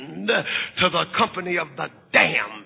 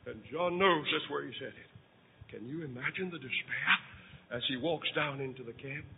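1 hushed; 6 semitones lower, 14 dB quieter than usual. Acted. Hushed reflection on despair.

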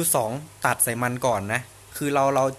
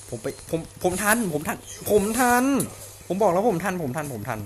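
excited; 4 semitones higher, 4 dB louder than usual. Real, not acted. Racing to help teammate.

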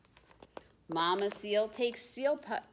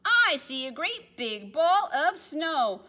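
high, loud cry of alarm; yelled; 8 semitones higher, 8 dB louder than usual. Acted. Penguin spotting the snowball, loud alarmed yell. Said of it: "One looked up and yelled".

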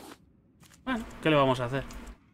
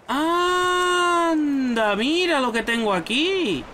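delighted; 10 semitones higher, much slower than usual. Real, not acted. Pleased discovery in game.

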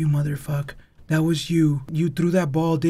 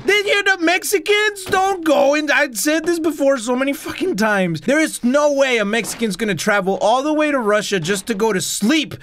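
comic rant; 6 semitones higher than usual. Real, not acted. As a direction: lecture the audience like a fed-up preacher. Ranting about Mexicans and ghosts.

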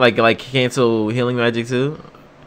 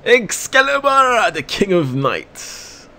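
stretched, much higher shout of the name; hyped call-out; 7 semitones higher, 5 dB quieter, slower than usual. Real, not acted. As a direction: call it out like a fan watching the strike. Hyped call-out while watching.

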